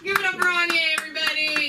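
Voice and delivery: high, projected host's cheer; rally applause for the act; announcer. Real, not acted. Host sending off a comic, high rousing announcement.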